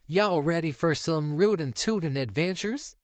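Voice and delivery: lightly voiced Southern cowgirl twang; southern drawl. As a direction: cowgirl Jessie rallying the gang, light Southern accent. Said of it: "her southern drawl".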